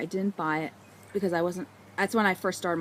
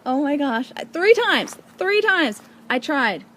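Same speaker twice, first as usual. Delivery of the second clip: thrilled; 7 semitones higher, 8 dB louder, slower than usual. Real, not acted. Thrilled she finally got it.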